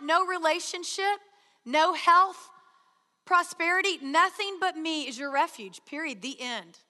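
full-force preaching. Acted. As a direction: drive each phrase home to the congregation.

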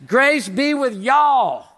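slow, loud Texan "Grace be with y'all" with a long drawn y'all; Texan drawl. Shown as a demonstration. Preacher jokingly quoting Paul as a Texan, loud slow drawl. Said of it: "It even ends with y'all".

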